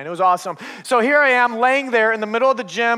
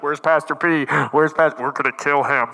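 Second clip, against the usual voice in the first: lower, menacing voiced kids; hunting kids; 8 semitones lower than usual. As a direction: play a mock-sinister gang of kids. Kids hunting the pastor at night.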